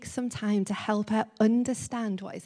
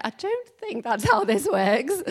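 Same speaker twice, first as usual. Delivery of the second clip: amused; 4 semitones higher, 7 dB louder than usual. Retold or imitated. Teacher amused at child.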